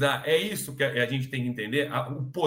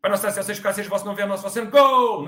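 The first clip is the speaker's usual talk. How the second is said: gabbled rote prayer, higher, bursting into a shout; mechanical prayer; 6 semitones higher, 6 dB louder, much faster than usual. Shown as a demonstration. Speaker mocks praying without meaning it, mechanical gabble into a shout. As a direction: rattle off the prayer mindlessly, then yell like a goal call. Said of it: "e não maquinal".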